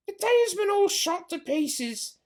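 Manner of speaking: high, slow put-on voice of a sharp farmer's wife; drawn out and dismissive, calling down; Missus Bean's voice. Missus Bean calls down the stairs, slow high put-on voice. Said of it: "said the voice from upstairs".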